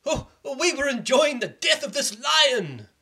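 high, slow gleeful voice; children thrilled by the dead lion; delighted children. Acted. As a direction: gush with delight. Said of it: "the children said".

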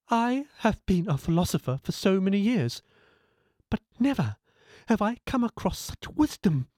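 a proud man suddenly amazed; humbled philosopher. Acted.

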